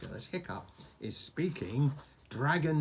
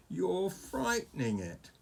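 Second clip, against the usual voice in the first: higher, slow, reproachful scolding; impatient; 6 semitones higher than usual. A narrator's reading. Hiccup scolds Fishlegs over the dragon, impatient. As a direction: draw it out as an exasperated reproach. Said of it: "said Hiccup impatiently".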